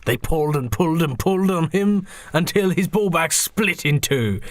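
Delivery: ordinary delivery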